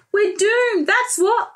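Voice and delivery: high despairing cry. Acted. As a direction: wail that all is lost. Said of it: "cried Cucumber".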